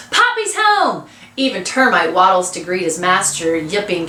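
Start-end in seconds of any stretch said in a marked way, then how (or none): 0.1-1.1 high, drawn-out joyful call; shouting. A narrator's reading. Boys race to greet their father, shouting. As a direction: call the news down the lane, stretched out.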